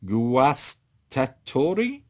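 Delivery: "Guastatori" sounded out slowly in separate chunks; tentative pronunciation. Real, not acted. War gamer unsure of an Italian unit name, "Guastatori" said slowly and tentatively. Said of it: "I don't know how do you pronounce it".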